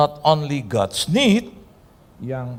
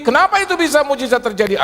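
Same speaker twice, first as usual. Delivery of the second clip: high, raised preaching voice; preaching at full force; 4 dB louder than usual. Acted. Preacher asks the congregation why miracles happen, fervent. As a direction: preach it out with fire.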